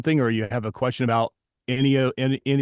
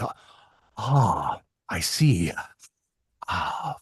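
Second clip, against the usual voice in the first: slow breathy drawl, heavy sighing exhales; drawn-out raspy breaths between the words; Jack Palance impression; 4 semitones lower, much slower than usual. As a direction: put on a thick, breath-laden movie-tough-guy voice. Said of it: "mesmerized by his voice, that breathing".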